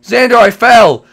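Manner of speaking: high, loud cry for help; panicked yell. Pretend. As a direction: shout it as you tumble. Pretend fall in game.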